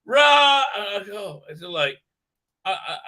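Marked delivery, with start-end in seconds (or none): 0.1-0.6 high loud shout; mock battle cry. Mocking the queen with a loud battle roar. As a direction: bellow it like a war cry. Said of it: "she says".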